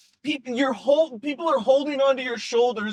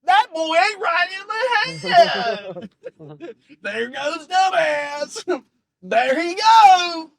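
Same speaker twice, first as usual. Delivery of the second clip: put-on Southern accent catchphrases; 6 semitones higher, 5 dB louder, slower than usual. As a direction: whip the crowd up in a Southern drawl. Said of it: "he says it in a Southern accent".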